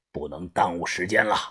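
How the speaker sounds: very low, soft voice of the boss; gang leader, grave. A narrator's reading. Gang leader explaining how few options they have, grave and hushed. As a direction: speak deep and hushed, weighing the options.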